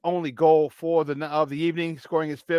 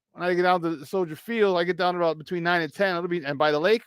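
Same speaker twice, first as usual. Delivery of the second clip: usual speaking voice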